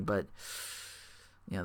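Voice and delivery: usual speaking voice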